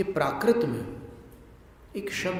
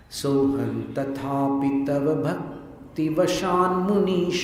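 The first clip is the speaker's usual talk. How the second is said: rhythmic recitation; 4 dB louder, slower than usual. Shown as a demonstration. Teacher recites the verse in metre for students. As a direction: chant the verse in steady metre. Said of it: "लय में पढ़ते हैं".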